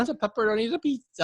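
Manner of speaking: mock Italian accent. Mock Italian pizza caller.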